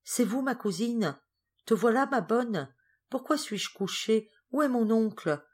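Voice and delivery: put-on a girl just woken from a faint, lost; confused sick girl. Geneviève waking, asking her cousin where she is, bewildered.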